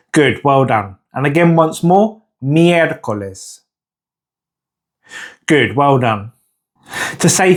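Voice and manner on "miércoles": slow "Miércoles" with a long "Miér"; stress on first syllable. Shown as a demonstration. Teacher giving a model to repeat, "Miércoles" slowly stressed on "Miér". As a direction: lean on "Miér" and stretch it out. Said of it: "it's the first syllable that is the stressed syllable".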